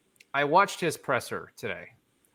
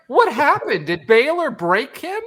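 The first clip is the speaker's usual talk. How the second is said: high, loud disbelief; shocked, can't believe it; incredulous; 11 semitones higher, 7 dB louder than usual. Retold or imitated. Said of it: "I'm like".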